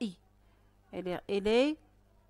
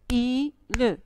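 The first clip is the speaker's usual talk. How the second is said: île broken as "I, le", louder; split into syllables; 7 dB louder than usual. Shown as a demonstration. Teacher has pupils clap the word, split into syllables. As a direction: clap out "I, le" beat by beat. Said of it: "Frappez le mot île".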